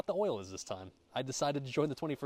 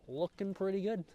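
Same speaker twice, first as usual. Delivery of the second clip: pleased; 10 semitones higher than usual. Real, not acted. Repeats praise of food.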